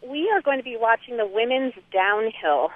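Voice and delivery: usual speaking voice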